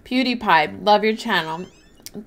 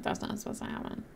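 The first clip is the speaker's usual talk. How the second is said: quick, stunned whisper; fast confused whisper; whispered to self; 15 dB quieter, faster than usual. Retold or imitated. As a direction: breathe it out in disbelief. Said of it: "I'm like".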